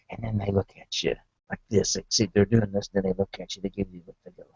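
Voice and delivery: ordinary talk, nothing marked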